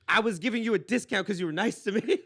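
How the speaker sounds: high, loud mock indignation, laugh underneath; indignant retort. Woman defending her price to him, mock indignant. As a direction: mimic her protesting loudly.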